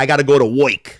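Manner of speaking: put-on "work" said as "woik" in a Brooklyn accent; lightly imitated Brooklyn accent, "woik". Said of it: "Colin Farrell did that Brooklyn accent".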